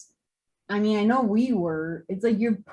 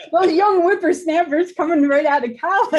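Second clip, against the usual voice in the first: put-on cranky old-timer, high and loud; old prospector; 8 semitones higher, 7 dB louder than usual. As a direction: grumble it like a crusty old geezer. Grumbling about young college hires.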